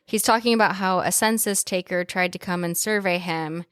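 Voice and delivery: ordinary talk, nothing marked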